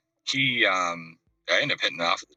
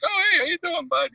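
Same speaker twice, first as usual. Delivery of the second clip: much higher, playful echo; mock high greeting. Greeting the singer at the mall, mimicking his high voice. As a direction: answer back in the same high mock voice. Teller answers in same high voice.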